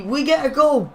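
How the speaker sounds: high, loud burst of excitement; excited goal call. Real, not acted. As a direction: call out the goal, pitch leaping up. Commentator announces a goal.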